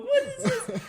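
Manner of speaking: very high, loud falsetto cry of disbelief; falsetto squeal. Retold or imitated. Gamer mimicking his own hype, falsetto squeal. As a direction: jump right up into falsetto. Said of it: "I'm in a falsetto going".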